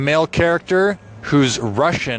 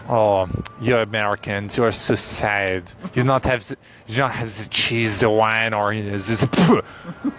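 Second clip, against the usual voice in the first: put-on French accent; 2 semitones lower than usual. Host mocking Americans as a typical Frenchman, in a French accent. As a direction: scoff at Americans in a French accent, ending in a spit. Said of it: "My typical French accent is".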